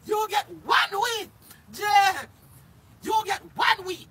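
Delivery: loud, high mocking call; jeering shout. Real, not acted. Jeering at Blinger for getting one week, loud taunting shout. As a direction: holler it out in high, punchy bursts.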